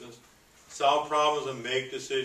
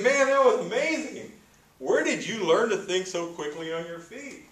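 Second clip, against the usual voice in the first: much higher, louder amazed exclamation; amazed butcher; 8 semitones higher, 5 dB louder than usual. Retold or imitated. Butcher marvels at the manager's quick save, high amazed. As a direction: marvel at his quick thinking.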